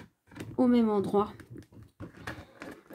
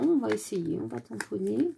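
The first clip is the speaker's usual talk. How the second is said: ordinary talk, nothing marked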